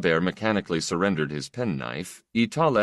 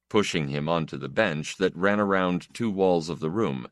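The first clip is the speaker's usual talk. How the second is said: usual speaking voice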